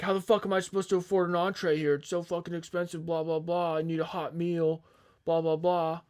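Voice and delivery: retold angry rant. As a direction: act out an irate customer's complaint, fast at first. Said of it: "he's just screaming at our manager".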